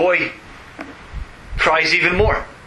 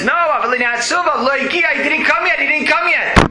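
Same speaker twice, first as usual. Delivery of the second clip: put-on crying boy; 10 semitones higher than usual. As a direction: wail it like a boy whose father hasn't come. Boy cries harder, father late.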